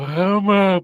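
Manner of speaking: yawning. Real, not acted. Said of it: "I yawned".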